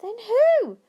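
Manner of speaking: high, loud excited question; eager cry. A narrator's reading. Charlie begging Grandpa to go on, eager cry. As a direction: burst out eagerly. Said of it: "cried Charlie".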